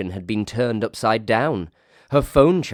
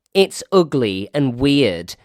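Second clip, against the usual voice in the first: slow, loud, higher declaration; loud announcement; 6 semitones higher, 7 dB louder than usual. Acted. Emily to her staff, announcing slowly and loudly. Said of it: "she announced".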